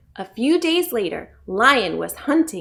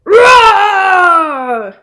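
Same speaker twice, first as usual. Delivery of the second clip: big, loud, high roar; lion roar; 8 semitones higher, 13 dB louder than usual. A narrator's reading. Lion woken by a mouse, roaring loudly. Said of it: "Lion woke up right away".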